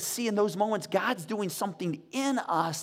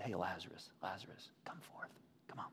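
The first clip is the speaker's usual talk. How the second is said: low, hushed, coaxing call; gentle near-whisper; 12 semitones lower, 18 dB quieter than usual. Acted. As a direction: coax him out very softly, almost whispering. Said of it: "He said it gently".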